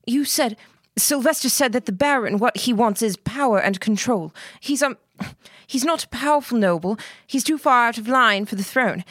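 high, hurried, stumbling plea; rushed, tripping over words. Acted. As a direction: blurt it out too fast to be clean. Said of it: "so fast she stumbled over her words".

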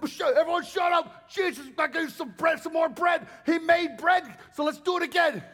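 shouting crowd. Retold or imitated. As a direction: shout down the room, eager for bread. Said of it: "So everyone said".

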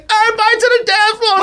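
high, fast mock party shout; imitating the groom rallying guests to dance; hyped call-out. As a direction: shout it like a hype man. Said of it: "He was like".

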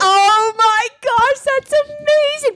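high, slow, squealing oh my gosh; gushing with real delight; freaking out. Real, not acted. Wife thrilled at the podcast ranking, excited squeal. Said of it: "like I'm freaking out".

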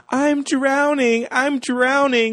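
high, slow fake panic; mock drowning cries. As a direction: call for help like you're drowning. Girl fakes drowning at camp.

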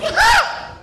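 piercing high shriek of fright; startled scream. Retold or imitated. Korean lady at the bar shrieks in fright. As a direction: shriek like she's been grabbed. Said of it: "And she goes".

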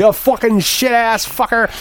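high, slow drawn-out trickster taunt; gloating leprechaun. Retold or imitated.

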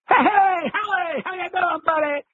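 extremely high squawking greeting, mimicking an eccentric dad; over-the-top impression of a zany dad; [name]. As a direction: greet a buddy in an absurdly high voice. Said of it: "He sounded just like Chris".

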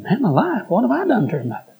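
baffled protest. Retold or imitated. Preacher answering complaint about his influence, retold bafflement. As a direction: act out protesting your innocence.

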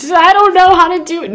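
very high, breathless mock panic, slowing at the end; hammed-up high-pitched despair; mock panicked self-critic. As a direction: squeal it like a frantic perfectionist. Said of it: "Just like".

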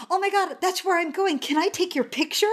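high-pitched gushing stranger, imitated; excited fan. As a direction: imitate a thrilled passer-by asking a favour. Passer-by thrilled to meet sign holder.

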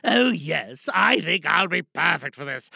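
Geronimo Stewart voice. Acted. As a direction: boast like a pleased self-important character. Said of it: "that's Geronimo Stewart".

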